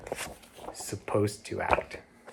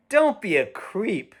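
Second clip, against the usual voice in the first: high, slow mocking kid voice; taunting boy; 12 semitones higher, 4 dB louder than usual. Boy next door jeering at William, high and slow. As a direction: sneer it down at him like a mean older kid. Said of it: "said the boy next door".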